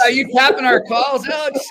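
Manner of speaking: playful tease. Real, not acted. Host teasing the listener about tapped calls, laughing. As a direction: playfully accuse him while laughing.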